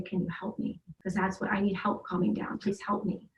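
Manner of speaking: soft imitation of a child asking for help; child pleading. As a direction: quietly voice the son's small plea. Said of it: "to say".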